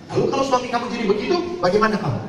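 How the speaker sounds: high and fast probing question; mock stern pastor pressing; pressing priest. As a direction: push her to face it. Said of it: "Lalu saya tanya".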